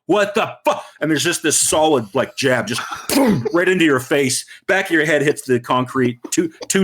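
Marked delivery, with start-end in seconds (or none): 0.1-0.8 high, outraged snap; mock affronted thug; hint of angry thug. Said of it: "he's like".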